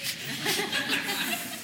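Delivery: light laugh. Real, not acted. Laughs at own joke.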